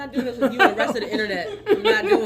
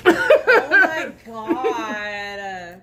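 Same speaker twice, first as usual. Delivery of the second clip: laughing; 3 semitones lower, 4 dB louder, much slower than usual. Real, not acted. Friend calls her stuck Capricorn.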